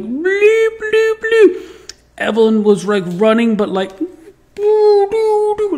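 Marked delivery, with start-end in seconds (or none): 0.0-1.9 put-on very high, drawn-out baby noises; slow-motion baby sounds. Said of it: "my baby's like". 4.0-5.8 very high, stretched-out noises; slow-motion sound. Retold or imitated. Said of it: "like, slow motion".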